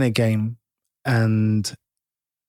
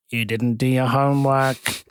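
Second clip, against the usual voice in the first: raised voice, slowly drawn out, quoting him; slow mock shout, only a little raised; retold loud call; 3 dB louder than usual. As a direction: imitate him calling out, moderately loud.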